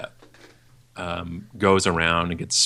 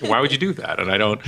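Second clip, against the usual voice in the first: ordinary delivery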